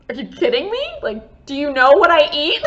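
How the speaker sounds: acting shocked outrage; incredulous. Telling the doctor she can't believe it, mock disbelief.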